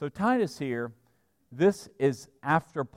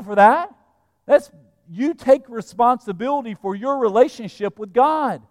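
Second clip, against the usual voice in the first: loud, raised-pitch admonishment; forceful preaching rebuke; 7 semitones higher, 8 dB louder than usual. Acted. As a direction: put the blame squarely on the listener.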